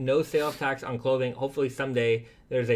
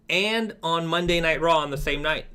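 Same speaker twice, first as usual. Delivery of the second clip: usual speaking voice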